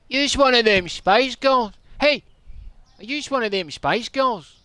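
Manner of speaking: high-pitched put-on Scottish accent; hammed-up Scottish heckle; Scottish challenge. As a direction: accost someone in mock Scots. Acted Scottish challenge to someone.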